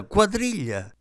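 high excited call repeated. Acted. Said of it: "battendo le mani e gridando".

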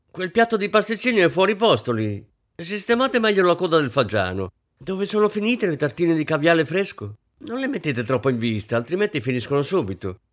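high, loud fussing hostess; fussy mistress scolding. A narrator's reading. Mistress nagging the waiters over the buffet, high fussy scolding. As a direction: bustle about nagging every servant.

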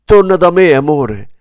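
high, loud anguished cry; desperate shout. A narrator's reading. Kleber screams after his lost love, high and loud. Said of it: "urlò".

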